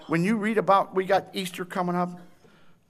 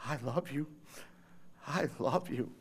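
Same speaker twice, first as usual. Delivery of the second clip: soft, low, tender voice of Christ; tender whisper of love; 6 semitones lower, 12 dB quieter than usual. Acted. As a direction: say it gently to each listener. Christ's quiet words of love.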